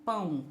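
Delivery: "pão" said in one go, low and even; one unbroken syllable. Shown as a demonstration. Teacher shows pupils pão is one syllable, said in one piece. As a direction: say "pão" as a single beat. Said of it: "a gente pronuncia de uma vez só".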